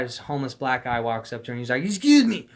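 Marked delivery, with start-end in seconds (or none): 1.8-2.5 high, loud garbled outburst; shrill-pitched mock shouting; imitated street stranger. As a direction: mimic a stranger yelling barely intelligible words. Mimics homeless man accosting friend.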